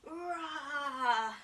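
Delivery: light playful rah; a gentler lion roar; teacher doing a small lion roar for kids. Pretend. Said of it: "a big push-up and a roar".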